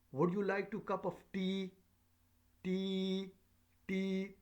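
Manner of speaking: high, loud question peaking on TEA; rising question intonation. Shown as a demonstration. Teacher demonstrating an offer as a question, rising up. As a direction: stretch each "tea" on a high held note. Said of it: "Here is a rising up".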